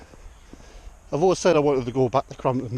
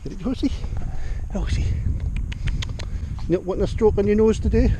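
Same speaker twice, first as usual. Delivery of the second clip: pet talk; 8 semitones higher than usual. Real, not acted. Cooing to horse.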